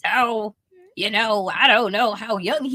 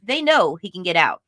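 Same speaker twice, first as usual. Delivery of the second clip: usual speaking voice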